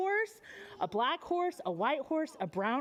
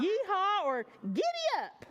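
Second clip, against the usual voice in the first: high, bright giddy-up call; a cowboy urging on his horse; 6 semitones higher than usual. Shown as a demonstration. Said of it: "what do cowboys say".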